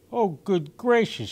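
high gasped shock, a touch loud; gasping surprise. A narrator's reading. Emily rushes in and finds the kitten at the piano, gasping in shock. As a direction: catch your breath in shock. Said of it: "she gasped".

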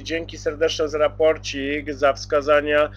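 normal talking voice

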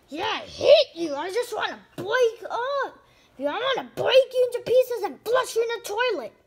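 high, loud furious rant; furious partner yelling about breaking up; angry rant. Acted. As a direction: spit out the breakup.

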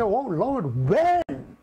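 high, incredulous cry of "when"; astonished questioning. Acted. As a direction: act out baffled believers questioning the Lord, pitch jumping up. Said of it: "those five would say".

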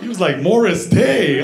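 ordinary talk, nothing marked

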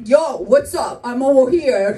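loud, exaggerated streetwise American accent; brash American accent, calling out a greeting. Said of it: "And I'm like".

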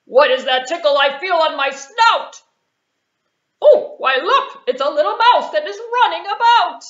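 loud, booming puppet lion; a grumpy king of the jungle, loud; big lion voice. Acted. Lion spotting the mouse on his snout, loud and booming.

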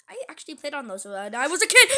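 ordinary delivery

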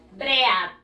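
"Bread" read letter by letter, "breh-ad"; "Bread" with Spanish vowels, bre-ad; as it is spelled. Shown as a demonstration. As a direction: sound out the a in "Bread". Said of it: "¿cómo se escribe?".

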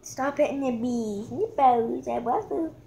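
ordinary delivery

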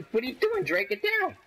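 very high, urgent squawk; alarmed yelling. Real, not acted. Player telling Drake to get down, alarmed yelling. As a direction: yell at a teammate in alarm.

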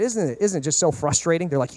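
usual speaking voice

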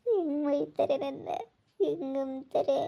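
soft, broken sobbing complaint; crying. Acted. Aunt complaining how they scold her, crying softly. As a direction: weep quietly between the words. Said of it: "ಅಳ್ಬೇಡಿ ಚಿಕ್ಕಮ್ಮ".

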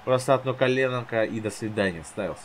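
ordinary delivery

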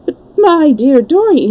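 high-pitched astonished protest; perplexed amazement. A narrator's reading. Lord Henry interrupts his friend Dorian's marriage news, amazed. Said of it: "in a perplexed amazement".

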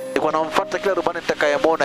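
usual speaking voice

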